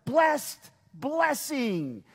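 both words stretched slow and high; slow emphatic. Shown as a demonstration. Preacher drawing out the doubled word slowly. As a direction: lean hard on "Blessed blessing". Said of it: "That's kinda what this is".

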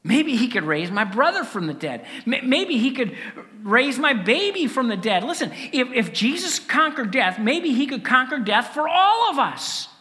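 building to loud, high elation; mounting hope. Acted. Disciples realising death could be conquered for all, mounting hope.

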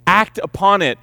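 usual speaking voice

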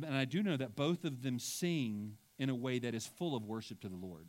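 ordinary delivery

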